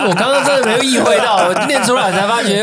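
high, laughing embarrassment. Real, not acted. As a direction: talk through the laughter.

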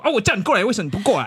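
mildly cross auntie demanding an answer; angry customer. Retold or imitated. Waiting auntie scolding the busy clerk, mildly angry. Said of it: "然后就很生气".

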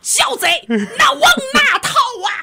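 high, loud Peking opera shout; opera warrior shout. Acted. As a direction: bellow like a Beijing opera general. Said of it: "我们刚才演大纲的戏".

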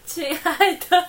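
put-on slow drawn-out baby voice. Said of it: "快一点 我们要挑战".

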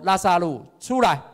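calling a dead man out of the tomb, loud and high. Acted. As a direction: shout the name like a command. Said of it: "他大声呼叫说". (大声呼喊拉撒路出来)

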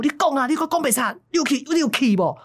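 high, pressing interrogation; angry demand. Retold or imitated. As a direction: force him to confess. Master presses the lying gambler. (师兄逼问赌徒)

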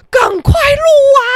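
high, loud, almost crying plea; excited yell. Real, not acted. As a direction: shriek it like you can't believe nobody filmed. Urging bystanders to film it. (又急又兴奋地尖叫)

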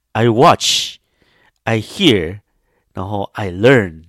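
each phrase set apart, slow and weighty; understated wise old father; slow wise words. Retold or imitated. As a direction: deliver it like a proverb.

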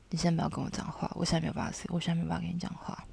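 soft, flat refusal that drops into a whisper; cold quiet brush-off. Retold or imitated. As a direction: shut him out quietly, fading to a whisper. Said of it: "非常冷淡的跟他们讲".

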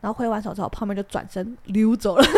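ordinary talk

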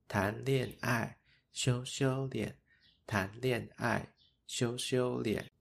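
voiced schoolyard teasing rhyme. A narrator's reading. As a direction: drag out each syllable like a mocking kids' chant. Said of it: "也跟着一起起哄".

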